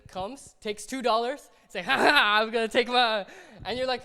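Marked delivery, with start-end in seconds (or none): 1.8-3.2 loud, cocky "ha ha" taunt; mocking taunt. Thief gloats loudly while taking the two dollars. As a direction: gloat while snatching the money. Taker mocks while grabbing money.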